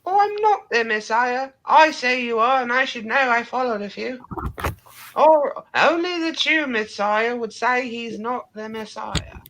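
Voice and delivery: Monty Python mother shrieking; shrill mother. Acted. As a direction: squawk like an outraged mum. Life of Brian mother impression.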